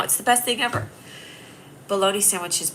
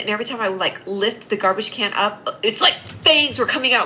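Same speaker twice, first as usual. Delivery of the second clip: usual speaking voice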